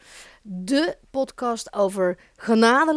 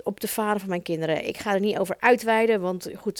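normal talking voice

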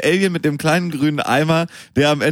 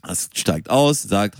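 usual speaking voice

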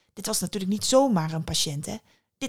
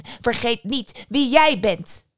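slow, loud and raised, each word driven home; "Vergeet niet wie jij bent" with JIJ hit hard; furious warning; 4 semitones higher, 5 dB louder, slower than usual. A narrator's reading. Mother Death puts her godchild in his place, slow emphatic anger. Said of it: "Moeder Dood was woedend".

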